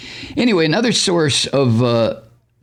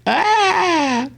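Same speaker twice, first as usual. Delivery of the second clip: high drawn-out fake cry; mock wail. Mocking Trump losing Twitter with a high fake wail. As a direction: wail like a child losing a toy.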